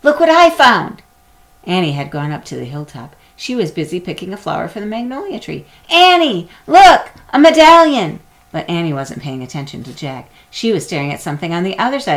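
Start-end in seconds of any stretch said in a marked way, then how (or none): 0.0-1.0 loud, eager shout across the hill; excited call. A narrator's reading. Jack calling to Annie. 5.9-8.2 shouting to get her attention; loud call. A narrator's reading. Jack calling, Annie not listening.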